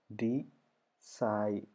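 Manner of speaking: Decide pulled apart as dee... side; long, separate syllables in "Decide". Shown as a demonstration. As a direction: sound out Decide slowly, pausing in the middle. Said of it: "രണ്ട് syllables വരണം".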